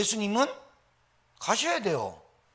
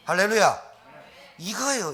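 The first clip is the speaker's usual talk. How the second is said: ordinary talk, nothing marked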